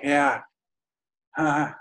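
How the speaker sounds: weird vague answers. Retold or imitated. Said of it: "which is kind of weird".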